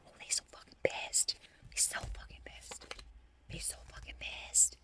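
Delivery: hushed gleeful whisper. Acted. Tarot reader whispering about angry foes. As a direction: whisper it with relish.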